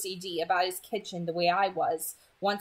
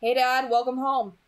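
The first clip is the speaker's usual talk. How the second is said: louder, brighter hello to dad; cheerful greeting; 5 semitones higher, 6 dB louder than usual. A narrator's reading. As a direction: welcome him home. Greeting father from kitchen.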